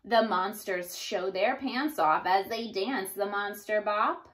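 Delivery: plain reading voice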